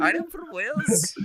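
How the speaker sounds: put-on high-pitched go at a Welsh accent; attempted Welsh accent. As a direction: copy a hammed-up Welsh accent. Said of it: "amazing Welsh accent".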